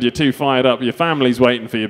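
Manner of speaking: ordinary talk, nothing marked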